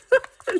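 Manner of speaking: a loud, squeaky burst of laughter; high laugh. Real, not acted. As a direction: crack up at mum. Laughing at mum.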